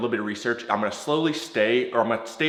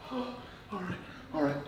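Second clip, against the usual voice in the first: strained cold breath; 4 semitones higher, 12 dB quieter than usual. Real, not acted. Enduring freezing cold water.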